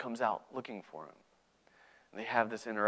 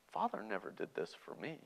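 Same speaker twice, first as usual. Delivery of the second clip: soft, slightly higher grumble; quiet resentment; 4 semitones higher, 12 dB quieter than usual. Acted. Older brother unhappy at the party, quietly resentful. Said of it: "he's not full of happiness".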